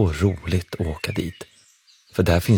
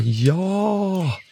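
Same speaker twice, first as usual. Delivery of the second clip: high, eager shout of yes; children shouting in chorus; 12 semitones higher than usual. A narrator's reading. Children calling out to Brummelisa in one eager shout. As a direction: cry out together like excited kids. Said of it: "Alla barnen ropar".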